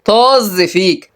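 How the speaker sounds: long, scornful dismissal; very slow, contemptuous brush-off; drawn-out contempt. Acted. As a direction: stretch the insult out slowly with disdain. Contemptuous insult in acted quarrel.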